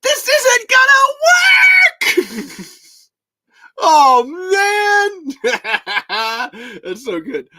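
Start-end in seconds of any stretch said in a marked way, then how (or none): 0.0-2.7 high, drawn-out despairing yell; Tremors movie impression. Host performs a movie line for viewers, despairing high wail. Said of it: "do 'em exactly in the voice". 3.8-5.3 long, high wailing shout; Tremors movie impression. Host performs a favorite movie line, high wailing yell. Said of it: "do 'em exactly in the voice".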